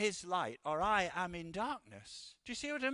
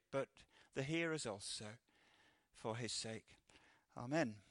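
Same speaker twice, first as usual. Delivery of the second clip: softer, lower, reverent prayer; 5 semitones lower, 6 dB quieter than usual. Acted. Preacher prays for the hearers in a soft, hushed voice. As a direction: pray quietly over the preacher and hearers.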